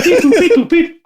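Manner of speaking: loud squeaky beep boop, laughing; high robot beeps. Retold or imitated. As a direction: chirp like an upset R2-D2.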